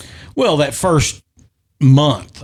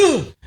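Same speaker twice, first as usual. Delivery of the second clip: a sudden high grunt of straining; imitated effort grunt; 12 semitones higher, 3 dB quieter than usual. Retelling his second baseman's leap for the ball, a high strained grunt. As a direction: mimic the lunge with a sharp high "Ugh!".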